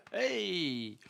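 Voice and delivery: usual speaking voice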